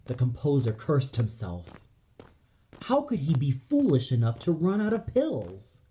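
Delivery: plain reading voice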